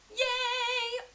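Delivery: high, bright squeal of joy; excited cheer. Real, not acted. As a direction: cheer like you just won. Announcing bought a house.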